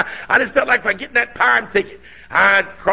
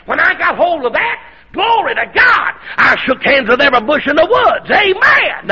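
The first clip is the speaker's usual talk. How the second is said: preaching at full force; 7 semitones higher than usual. Real, not acted. Preacher shouting to the congregation at the climax. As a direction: shout the glory out.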